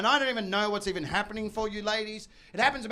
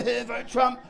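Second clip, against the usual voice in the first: normal talking voice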